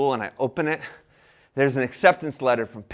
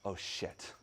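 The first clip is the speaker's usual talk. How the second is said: unvoiced, hushed oh shit; a stunned whisper; whispered curse; 10 semitones lower, 19 dB quieter than usual. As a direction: breathe it out in dismay. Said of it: "my exact words".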